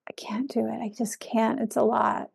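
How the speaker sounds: hushed, half-whispered self-doubt; small, soft voice of giving up; inner "I can't" voice. Acted. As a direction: say it very softly, as a thought to yourself. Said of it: "And that voice shifted over time".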